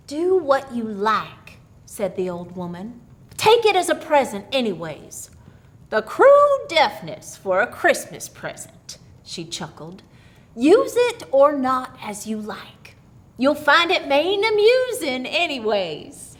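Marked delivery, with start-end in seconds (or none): 0.1-1.6 put-on old country woman. Old woman coaxing the girl Mary with her strange gift. 3.4-9.0 put-on sly old woman offering a gift, a bit higher and louder; old country woman. Old woman coaxing the girl Mary with her strange gift. 10.5-16.3 put-on sly old woman offering a gift, a bit higher and louder; old country woman. Old woman coaxing the girl Mary with her strange gift.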